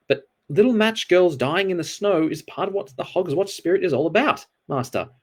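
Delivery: Albert desperately pleading with Death. A narrator's reading. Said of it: "said Albert desperately".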